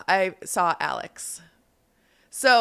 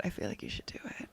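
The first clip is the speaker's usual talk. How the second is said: whisper; 13 dB quieter, faster than usual. Real, not acted. Urging him, measured whisper.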